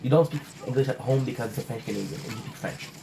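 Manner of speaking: normal talking voice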